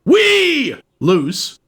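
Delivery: high, drawn-out shout on WEE; squealed middle name. Pretend. Host introduces co-host, squealing the middle name. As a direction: belt out WEE as a silly nickname.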